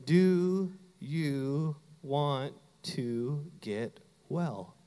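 very slow, separated words with long gaps; slow, deliberate question. Acted. Preacher repeating Jesus' question to the church, slow and deliberate. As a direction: let each word stand alone.

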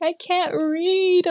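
high, slow, tearful wail with a laugh; half-laughing, half-sobbing it out; a laughing mock sob; crying. Crying at the ATM, retold.

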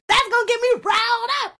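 high, loud exclamation; riled-up outburst. Acted. Sister explains the button being pressed, high riled cry. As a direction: burst out high and worked up.

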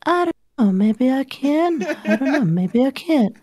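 very high, squeaky character voice; put-on silly voice. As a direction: show off a high-pitched funny voice. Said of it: "when people are like, "Can you do voices?".

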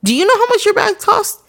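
high, heated mock rant at the seller; imagined angry comment. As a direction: tear into them like an outraged commenter. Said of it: "I wanted to comment and be like".

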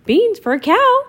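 put-on Mickey refusing the old woman's offer; outraged disbelief. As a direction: shock at the silly trade. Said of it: "cried Mickey".

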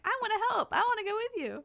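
high, eager little sidekick voice, well above own; eager Seth. Retold or imitated. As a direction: play the keen puppy-dog sidekick. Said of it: "Seth's just like".

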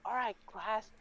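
high, gleeful call to the class; upbeat mock-teacher voice; delighted teacher. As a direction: address the class in high good cheer. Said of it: "she would just be like".

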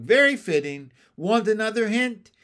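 loud, taunting, raspy; smug frog taunt; snickering bullfrog. A narrator's reading. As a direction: needle them with a snicker. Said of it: "He snickered".

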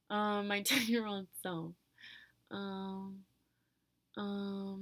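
normal talking voice

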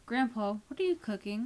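put-on girl's voice calling from the doorway. A narrator's reading. Rachel calls to grandpa.

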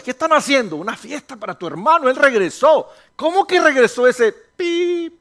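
high, loud indignant outburst; angry older brother. Acted. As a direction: spit out the brother's resentment. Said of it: "él se enoja y dice".

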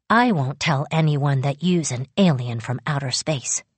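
plain reading voice

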